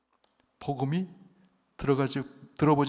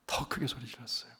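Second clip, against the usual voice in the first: quiet; 12 dB quieter than usual. Shown as a demonstration. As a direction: let 더 크게 소리 질렀어요 fall to a hush.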